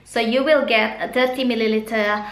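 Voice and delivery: normal talking voice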